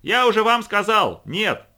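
slow, high, loud shout. A narrator's reading. Quota yelling his refusal over the machine, slow loud shout. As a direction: yell each word over the noise. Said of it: "оба они орали во всё горло".

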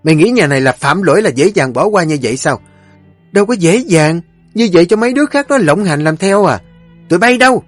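loud, high, fast tirade of commands; mother-in-law raging at the servants, shouting orders; furious matriarch. Acted. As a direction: bark orders to have the maid beaten.

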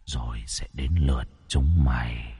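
low, soft, menacing ghostly threat; eerie ghost voice. A narrator's reading. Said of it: "giọng nói ma mị vang lên".

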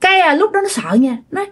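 ordinary delivery

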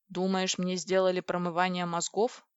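ordinary delivery, nothing marked

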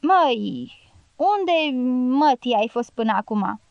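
downcast, drawn-out opening word; mildly crestfallen reproach; dejected voice. A narrator's reading. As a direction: ask wearily, with the heart sunk. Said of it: "spuse cu glas căzut".

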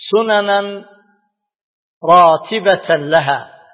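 ordinary talk

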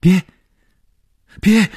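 loud, high-pitched panicked cries; terrified roar. Acted. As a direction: shout it in sheer horror to stop him. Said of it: "惊恐的大吼着". (惊恐地尖声大吼)

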